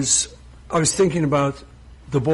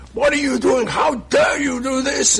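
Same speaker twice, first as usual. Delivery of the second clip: retold furious caller; 10 semitones higher than usual. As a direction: imitate an angry caller shouting down the phone. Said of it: "I got this furious phone call".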